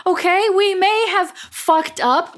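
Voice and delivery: high, slow, emphatic plea; earnest high-pitched appeal; pleading protest. Acted. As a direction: insist on it, begging to be believed. Captive pleads their case.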